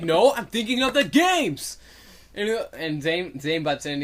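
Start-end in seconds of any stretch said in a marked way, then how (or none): 0.0-1.8 loud, high, drawn-out retort; mock bickering. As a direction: play an exasperated squabbler insisting. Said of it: "bickering back and forth".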